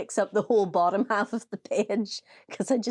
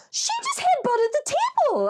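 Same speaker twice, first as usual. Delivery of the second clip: much higher, squealing protest; mock protest; 3 dB louder than usual. Friend defends herself to the room, high protest. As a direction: squeak it out in disbelief. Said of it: "she was like".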